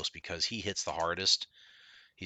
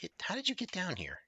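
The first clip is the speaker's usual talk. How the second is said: surprised; 12 semitones higher, 4 dB quieter than usual. Real, not acted. Surprised by enemy.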